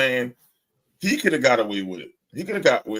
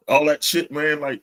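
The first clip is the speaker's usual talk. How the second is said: normal talking voice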